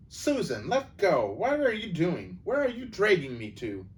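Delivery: put-on a boy suddenly being yanked along; startled boy protesting. Narrator voices Peter alarmed.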